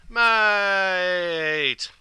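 long drawn-out, high, loud "Mate"; matey greeting. Shown as a demonstration. Explainer demonstrates greeting a good mate, hearty drawn-out call. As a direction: hail a good mate, stretching the word out. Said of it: "used as a greeting between good mates".

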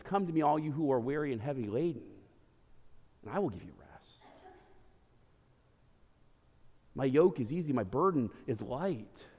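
voz suave y grave, consoladora; tender Jesus. Acted. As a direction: habla bajito, como quien consuela al cansado. Jesus speaks tenderly to the weary.

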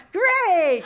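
high, shouted reply; loud exclamation. Retold or imitated. Acted exclamation in retold exchange.

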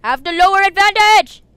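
high, loud game yell; shouting out. Real, not acted. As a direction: holler it mid-fight. Calling out in game fight.

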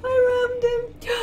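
high, slow triumphant cry; triumphant shout. Real, not acted. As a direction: crow it out loud and slow. Triumphant cry after ramming.

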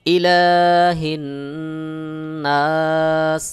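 ইলাহিন নাস drawn out; tajweed recitation with madd arid. Shown as a demonstration. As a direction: recite it like a qari. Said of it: "মাদ্দে আরজি হবে".

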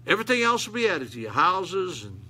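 ordinary talk, nothing marked

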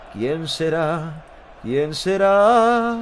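lento, arrastrando cada sílaba; voz misteriosa de broma. Pretend. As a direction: alarga la pregunta con misterio burlón. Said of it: "repite la frase cambiando la voz". (voz de misterio exagerada y muy lenta)

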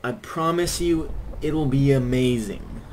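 high, loud pleading, hammed up; mock begging plea. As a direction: beg the team dramatically, tongue in cheek.

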